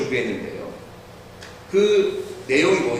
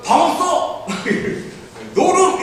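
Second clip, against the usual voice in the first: put-on childish innkeeper; 12 semitones higher than usual. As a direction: blurt the line like a slow boy. [name]'s scripted innkeeper lines. (높고 크게 서툴게 외치는 아이 목소리)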